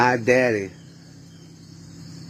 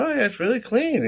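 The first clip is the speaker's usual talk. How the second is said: mock-impressed mom. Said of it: "she, like, looks around".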